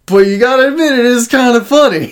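imitating the comedian's cheeky confession; cheeky admission. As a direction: squeak out the guilty punchline. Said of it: "he admits that".

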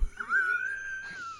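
high, cracking howl of laughter; shrieking laugh. Real, not acted. Laughing at own story.

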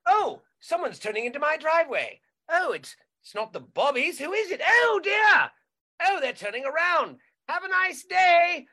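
lightly put-on English, fussing then cheerful; a polite Brit waving off lost tourists; mock English accent. English homeowner seeing a car turn around, mock English accent.

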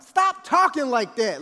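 slow, loud, high order to stop; exasperated scolding. Retold or imitated. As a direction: scold the kids with drawn-out emphasis. Retold father snapping at children.

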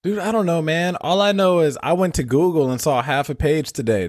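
ordinary talk

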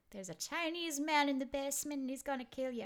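put-on Irish accent. Grandmother threatening the grandkids with the basement man, Irish accent. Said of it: "I just gave her an Irish accent".

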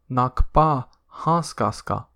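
usual speaking voice

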